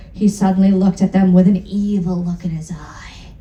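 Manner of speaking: whispered, fast narration; whispered, quick and sneaky; sinister whisper. Acted. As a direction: breathe it out like a secret.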